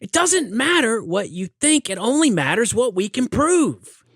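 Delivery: high, slow, mocking lecture voice; mock lawyer lecture. Mimicking Cruise lecturing the lawyer, high and slow. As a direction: lecture her slowly in a high put-on voice.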